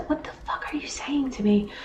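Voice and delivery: shocked whisper. Real, not acted. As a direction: breathe it out in disbelief. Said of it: "whispered reaction".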